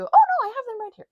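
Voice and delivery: high, loud burst of correction; sudden realisation. Real, not acted. Vlogger remembering the books are right here, sudden realisation. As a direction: jump up in pitch as you catch yourself.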